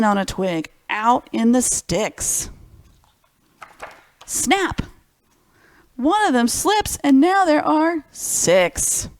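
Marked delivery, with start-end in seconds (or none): 4.3-9.1 much higher and louder, slow at the end; dramatic reveal. Acted. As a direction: make the kids gasp at the fall. Dramatic twig snap in story.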